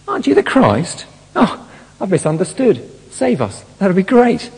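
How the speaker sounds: high, falsely naive pleading voice; mock innocent plea. Shown as a demonstration. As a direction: play it as a sincere misunderstanding. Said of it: "No, this is angry".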